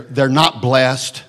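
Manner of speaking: ordinary talk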